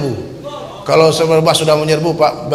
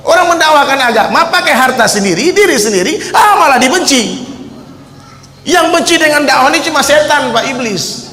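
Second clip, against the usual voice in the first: higher and louder, driving and urgent; impassioned preaching; 8 semitones higher, 3 dB louder than usual. Acted. Preacher exhorting the crowd not to call preachers deviant, forceful. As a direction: push the congregation to support dakwah.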